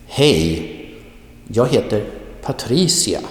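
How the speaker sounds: "jag heter" quick and light, "Patricia" punched; stress on Hej and Patricia. Shown as a demonstration. Teacher says listen again, stressing "Hej" and "Patricia". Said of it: "you read them a little bit more quickly".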